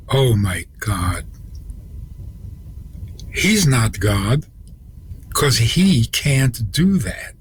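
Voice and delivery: slow, stunned retold realization; drawn-out words as the truth sinks in; dawning realization. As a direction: imitate someone whose face has just fallen. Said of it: "And then her face fell".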